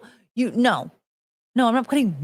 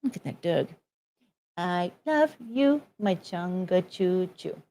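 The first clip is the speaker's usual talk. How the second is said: cooing to dog; 3 semitones lower, 7 dB quieter, slower than usual. Acted.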